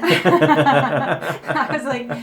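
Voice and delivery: laughing. Real, not acted. Cracking up at cohost's joke.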